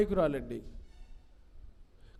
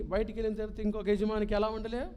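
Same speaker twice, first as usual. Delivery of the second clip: usual speaking voice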